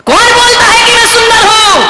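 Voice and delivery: high voice protesting; who says I'm happy, high and hurt; indignant swan. A narrator's reading. Swan protests being beautiful. (ऊँची आवाज़ में हंस का विरोध)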